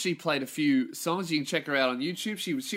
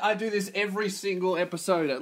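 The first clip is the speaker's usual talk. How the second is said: ordinary delivery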